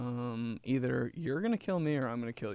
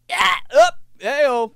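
much higher, louder rooster-like wake-up crow; mom rooster call; 14 semitones higher, 13 dB louder, slower than usual. Retold or imitated. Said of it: "That's like her rooster".

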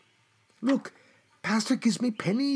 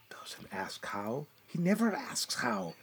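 a bitter whisper, much softer; whispered aside; 6 semitones lower, 9 dB quieter than usual. Acted. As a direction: mutter it under your breath, almost voiceless. Bitter aside about the pastor.

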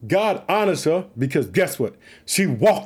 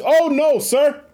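high, louder pleading cry; pleading woman; 8 semitones higher, 4 dB louder, slower than usual. Acted. Preacher acting Hannah answering the priest, high pleading.

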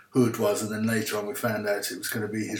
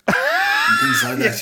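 high, slow cheeky squeal; squeaky mock innocence; 8 dB louder, slower than usual. Real, not acted. Caller asking about swearing, in a high cheeky squeal.

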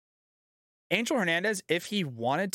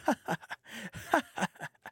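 mock laugh; 5 semitones higher, 5 dB quieter than usual. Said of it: "He's like".

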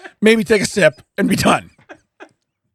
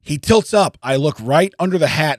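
usual speaking voice

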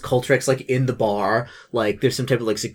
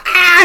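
high, loud fleeing shriek; mock scream; 10 dB louder than usual. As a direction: scream as the character runs off. Character screams and runs out.

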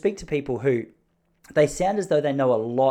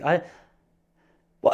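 ordinary talk, nothing marked

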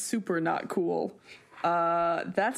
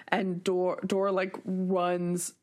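ordinary talk, nothing marked

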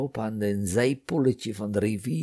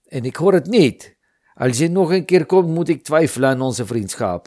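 angry; 6 semitones higher, 8 dB louder than usual. Acted. Friend loses patience with hero.

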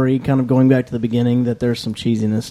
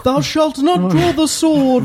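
high, slow, grand Shakespearean declaiming; hammy stage actor; 13 semitones higher, slower than usual. Pretend. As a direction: overact it like an old stage actor. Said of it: "It does sound funny".

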